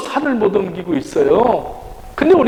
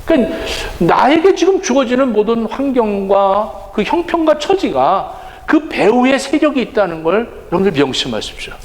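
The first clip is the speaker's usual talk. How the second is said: ordinary talk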